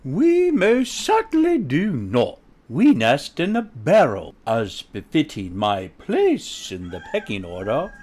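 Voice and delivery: high, offended grandeur; haughty. A narrator's reading. Mother hen defends her place in pecking order, haughty. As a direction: correct him with lofty dignity. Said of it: "Sapphira haughtily replied".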